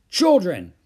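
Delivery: loud, high maternal call; exasperated mother's shout; crying out. A narrator's reading. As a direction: break up the squabble sharply. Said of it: "cried Mother".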